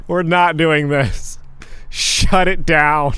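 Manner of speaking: slow firm shutdown. Real, not acted. Mock shutting down topic.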